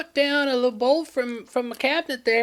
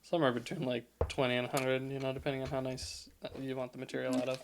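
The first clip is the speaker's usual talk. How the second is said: much lower and softer mock answer; deep voice; 11 semitones lower, 12 dB quieter, faster than usual. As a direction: drop into a low lazy murmur.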